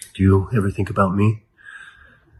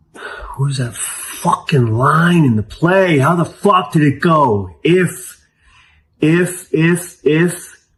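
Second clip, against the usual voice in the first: higher, slow, frustrated groping for a line; straining to remember; 7 semitones higher, slower than usual. Acted. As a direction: rack your brain, stuck on the first word. Struggling to recall a play's line.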